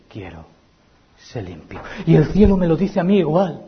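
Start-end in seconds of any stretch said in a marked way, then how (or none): 0.1-1.8 very soft and low; hushed answer. Acted. Preacher voicing Jesus' healing word, hushed. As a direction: say it gently, almost breathed.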